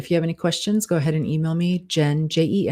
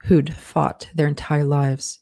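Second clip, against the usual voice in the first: steady dictation with spoken punctuation; dictating; 2 semitones lower than usual. Acted. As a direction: read it out like dictating a draft. Author dictates novel with punctuation.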